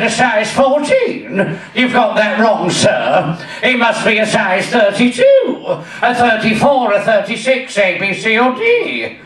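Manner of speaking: slushy-voiced camp shop assistant; John Inman. Shop assistant correcting a customer's bra size, camp impression. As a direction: draw out key words in camp disbelief. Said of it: "standing there was the late, great John Inman".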